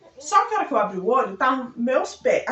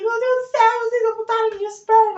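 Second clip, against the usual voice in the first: high, loud wailing shriek; panicked scream; 11 semitones higher, 6 dB louder than usual. Retold or imitated. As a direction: cry out as if your legs were gone. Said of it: "Quando eu gritei". (grito desesperado e agudo)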